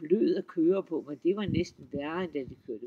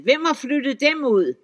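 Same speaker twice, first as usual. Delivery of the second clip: high, loud troll shout; shrieking troll; 6 semitones higher, 8 dB louder than usual. A narrator's reading. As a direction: demand it in alarm. Troll shrieking about furniture.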